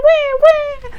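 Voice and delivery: squeaky high wee, wee sounds; very high playful baby squeaks; babyish wee. Pretend. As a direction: squeal it high like tiny babies. Babies on the bus go wee.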